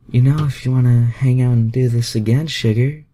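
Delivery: high, loud, teasing female voice; a playful Southern belle asking; flirty Rogue. A narrator's reading. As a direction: tease him high and bright. Rogue character dialogue.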